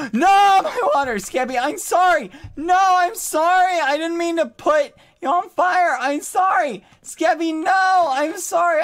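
high, loud frantic apology; panicked apologizing. Real, not acted. Apologizing to Skibby after setting him on fire, frantic loud yelps.

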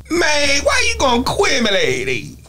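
high mock slur, "quiz me like that" melted together; slurred rapper mumble. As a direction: mimic a rapper blurring "quiz me like that". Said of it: "Here's what he said".

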